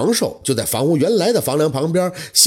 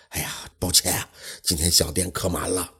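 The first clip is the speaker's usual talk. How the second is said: lower, softer innkeeper turning a guest away; apologetic innkeeper; 8 semitones lower, 7 dB quieter than usual. Acted. Innkeeper apologizing to the guest, lower and softer. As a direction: innkeeper sorry, but no rooms tonight.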